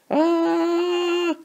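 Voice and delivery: a long, very high excited scream going down the hill; roller coaster scream. Shown as a demonstration. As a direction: let out a thrilled coaster-ride squeal. Top of the hill, drop.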